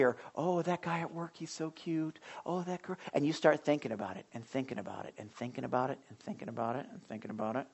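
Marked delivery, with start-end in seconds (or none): 0.3-3.0 swooning daydream. Retold or imitated. Voicing a crush daydream.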